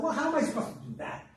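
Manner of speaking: high, quick protest; exasperated little girl. A narrator's reading. Little girl told to ask the gods, exasperated. Girl baffled by impossible task.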